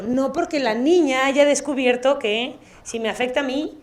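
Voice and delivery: usual speaking voice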